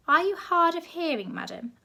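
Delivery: high, loud, fussy posh voice; a plummy official begging for order; posh gentleman. A narrator's reading. Posh zoo director pleading with the crowd, loud and high.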